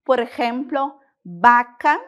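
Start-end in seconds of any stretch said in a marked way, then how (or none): 1.2-2.0 "vaca" starting with a B sound; Spanish "vaca", pronounced like "baca". Shown as a demonstration. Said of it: "o V e o B possuem o mesmo som".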